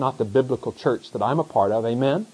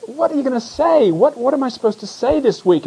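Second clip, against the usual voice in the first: pleading with the Lord; 10 semitones higher, 3 dB louder than usual. Retold or imitated. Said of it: "you go to the Word and you say".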